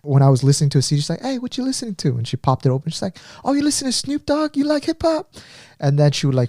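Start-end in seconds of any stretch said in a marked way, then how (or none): 1.2-2.2 casually nosy, voice lifted high; imitating a friend's eager question; perky curious friend. Acting out friend's curious greeting. 3.5-5.3 much higher, imitating pleasant surprise; high-pitched excited discovery; delighted friend.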